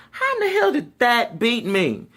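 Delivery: high baffled outburst; can't believe that beat you; incredulous. Retold or imitated. Drum major watching the tape, high incredulous outburst. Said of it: "I'm like".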